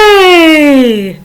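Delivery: bright called hey. Shown as a demonstration. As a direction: call hey across a room. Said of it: "maybe a little higher".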